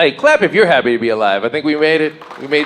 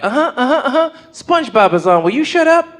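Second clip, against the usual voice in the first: put-on much higher, dismissive kid voice with a laugh; bored kids; 12 semitones higher than usual. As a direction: brush him off like impatient little nephews. Nephews brushing him off.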